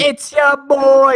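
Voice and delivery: slow hype-man greeting; drawn-out catchphrase. Shown as a demonstration.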